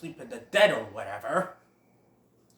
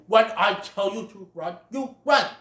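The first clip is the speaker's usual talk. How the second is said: louder, higher stern lecture; stern scolding father; 4 semitones higher, 7 dB louder than usual. Retold or imitated. Great Prince scolding Bambi, stern. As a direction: scold him hard. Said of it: "changes it to".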